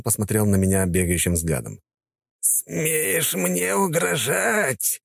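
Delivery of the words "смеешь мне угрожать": slow, high hissed threat; hissing old count. Acted. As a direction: hiss it out slowly, high-pitched. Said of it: "прошипел он".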